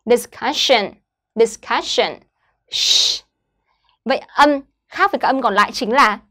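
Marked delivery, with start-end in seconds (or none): 2.7-3.2 "Sh" as a long, heavy hushing sound. Shown as a demonstration. Teacher isolates the sound in discussion, heavy SH sound. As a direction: hold "Sh" alone, unvoiced, no vowel after it. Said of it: "được đọc là sh, nặng".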